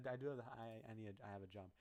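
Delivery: usual speaking voice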